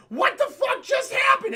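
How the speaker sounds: high, loud disbelief; shocked yell. Retold or imitated. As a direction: scream it in total shock. Characters shocked after punch.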